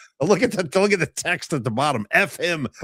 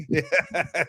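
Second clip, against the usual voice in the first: ordinary talk, nothing marked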